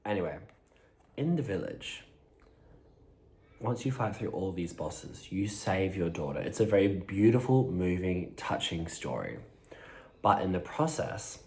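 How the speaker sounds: usual speaking voice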